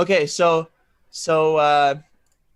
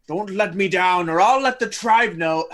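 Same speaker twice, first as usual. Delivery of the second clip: threatening voice; 4 semitones higher than usual. Acted. Character threatens Ulrich.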